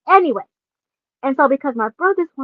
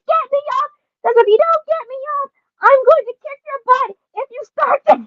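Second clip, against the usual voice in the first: very high frantic demand; high pitched scared child threatening; panicked threat; 13 semitones higher than usual. Retold or imitated. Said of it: "screaming and panicking".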